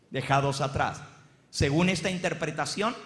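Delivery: usual speaking voice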